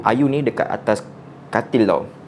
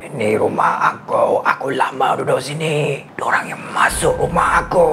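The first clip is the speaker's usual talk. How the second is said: possessing spirit. Acted. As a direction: growl it like the thing inside her. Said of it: "Pakcik tu terus cakap lagi".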